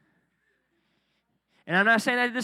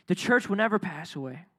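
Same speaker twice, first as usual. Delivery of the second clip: ordinary talk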